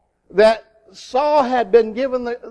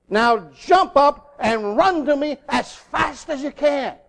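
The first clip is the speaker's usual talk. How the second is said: higher, urgent fatherly order; stern; 4 semitones higher than usual. Retold or imitated. Father calling his son to run to him, stern. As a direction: urge the child to run at once. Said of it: "very sternly he said to him".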